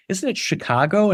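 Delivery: "Chicago" with an open "cah", asked as a question; questioned standard pronunciation. Retold or imitated. L.A. audience querying the actor, "Chicago" said "shi-KAH-go". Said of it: "they said".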